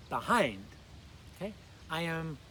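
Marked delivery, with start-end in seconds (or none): 0.1-0.6 "Behind" stressed on its second syllable, be-HIND. Shown as a demonstration. Teacher models for learners, "Behind" stressed on "hind". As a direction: punch the "hind" in "Behind", then fall. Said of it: "Accents on the second syllable".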